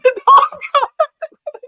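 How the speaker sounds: laughing so hard it goes high; can't hold back the laugh; high squealing laugh. Real, not acted. Teller laughing at his own story.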